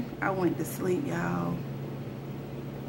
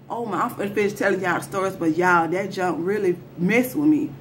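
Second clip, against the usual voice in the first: plain reading voice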